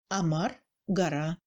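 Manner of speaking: "amar, gara" with A for written O. Shown as a demonstration. Teacher showing akanie, "amar, gara" with clear A. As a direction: open the unstressed O to A in "amar, gara". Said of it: "on entend nettement le A".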